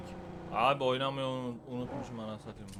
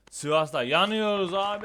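loud complaint; 7 semitones higher, 9 dB louder than usual. Real, not acted. Complains about the heat.

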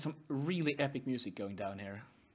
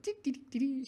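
high ticking noise; 11 semitones higher than usual. Pretend. Mimicking keychain sound.

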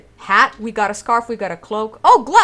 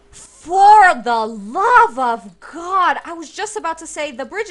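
exasperated outburst; 7 semitones higher, 7 dB louder, slower than usual. Real, not acted. More spiders keep attacking.

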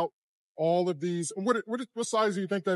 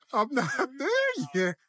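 laughing through the words, pitched higher; 7 semitones higher than usual. Real, not acted. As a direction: giggle out the line as you repeat it. Echoing partner, laughing.

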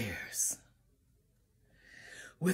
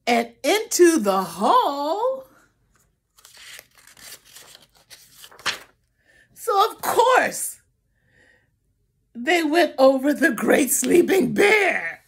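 louder, rising climax; building excitement; 3 semitones higher, 5 dB louder than usual. Acted. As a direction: build it up to the big moment. Story climax read to child.

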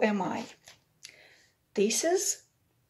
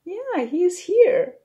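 excited; 8 semitones higher, 8 dB louder, slower than usual. Real, not acted. Excited reply to child, high and loud.

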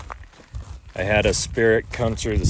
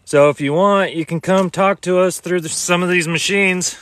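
calling out; 8 semitones higher, 5 dB louder than usual. Acted. Addressing spirits in the cemetery.

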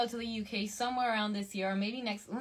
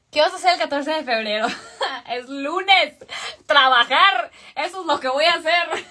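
loud, raised voice breaking into laughs; laughing; 4 semitones higher, 10 dB louder than usual. Real, not acted. Streamer answering a Valentine's question, loudly laughing. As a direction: blurt it out between bursts of laughter. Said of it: "qué, qué risa".

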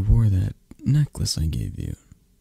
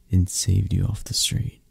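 normal talking voice

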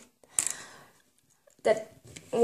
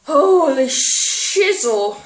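slow wail; 3 semitones higher, 4 dB louder, much slower than usual. Real, not acted. Reacting to barf flavour.

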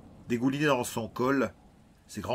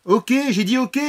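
full-force yell, much higher and louder; shouting with all his might; 14 dB louder than usual. Acted. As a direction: scream it over the storm. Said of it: "cria Ryan de toutes ses forces". (crié de toutes ses forces)

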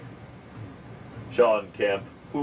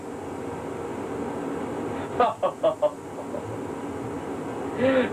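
airy giggle; 12 semitones higher, 5 dB quieter than usual. Pretend. Pretend chat laughter.

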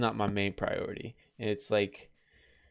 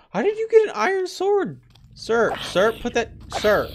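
very high, loud put-on voice; squeaky mock voice; 12 semitones higher, 9 dB louder than usual. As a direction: squeak at the armed mob like a cartoon. Voicing a mob with a sword.

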